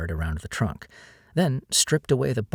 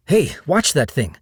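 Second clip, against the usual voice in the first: a louder, higher sharp warning; alarmed warning; 6 semitones higher, 6 dB louder than usual. Acted. Diego warning Jillian about the machete, a loud alarmed call. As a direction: call out to stop her swinging. Machete swung near his face.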